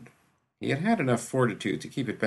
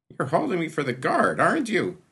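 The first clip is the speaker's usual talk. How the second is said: a high, loud, whiny attempt at a snarl; snarl turned whine; 9 semitones higher, 5 dB louder than usual. A narrator's reading. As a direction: try to growl but it comes out as a whine. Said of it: "his attempt at a snarl emerging as more of a whine".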